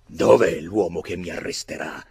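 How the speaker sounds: sfida gridata, più forte; grido di sfida. A narrator's reading. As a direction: lancia la sfida a voce alta. (Barry sfida le guardie, gridando)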